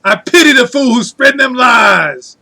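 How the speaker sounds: gruff tough-guy shout. Pretend. Mr. T catchphrase shouted.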